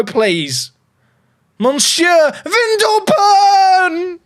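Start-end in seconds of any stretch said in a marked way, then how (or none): 1.6-4.2 high, long-stretched shout of the name; excited commentary cry. Real, not acted. Streamer urges his French player to score, drawn-out cry. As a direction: cry the name out, holding the last syllable.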